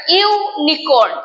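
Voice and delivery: ইউনিকর্ন said slowly with a clear yoo sound; example pronunciation. Shown as a demonstration. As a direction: stretch out ইউনিকর্ন starting with yoo. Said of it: "separate pronounce হচ্ছে ইউনিয়ন".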